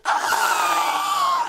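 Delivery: very high, loud shout of delight; joyful cheer. Retold or imitated. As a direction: cheer like the whole kitchen. Coworkers cheer.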